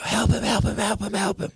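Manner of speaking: high, frantic calls for help; panicked plea. Acted. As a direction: beg someone to save him. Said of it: "they go".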